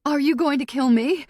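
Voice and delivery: high, loud frightened question; frantic. Acted. Captive asking her attacker her fate, frantic. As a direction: plead to know, rising in panic. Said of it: "I'm frantic".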